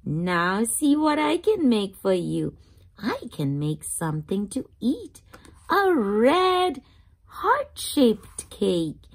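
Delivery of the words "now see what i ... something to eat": ordinary delivery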